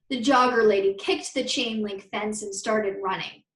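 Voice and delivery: ordinary delivery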